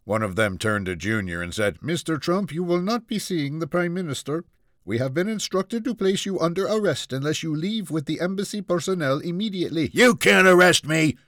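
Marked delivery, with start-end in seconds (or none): 1.8-9.9 high-pitched officer voice at normal volume; Indian security officer. Acted. Indian security officer warns Junior. 9.9-11.2 high, loud, outraged protest; defiant shout. Acted. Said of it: "Junior shouted".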